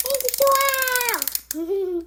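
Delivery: very drawn-out high "Ai, pessoal"; excited call. Real, not acted. Dog arriving, exclaims.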